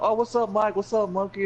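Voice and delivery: high-pitched, chirpy mock hello; imitated girl's greeting. Emily greets Mike and Monkey, imitated high greeting.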